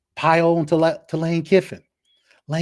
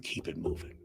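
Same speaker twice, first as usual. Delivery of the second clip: sly whispered send-off; taunting whisper; 9 semitones lower, 15 dB quieter than usual. Real, not acted. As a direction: drop to a breathy hush, mocking. Taunting Brian Kelly at rant's end.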